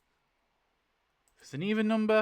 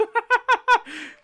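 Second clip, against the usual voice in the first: loud high laugh; 13 dB louder than usual. Real, not acted. Gamer cracking up at the random death roll, loud laugh.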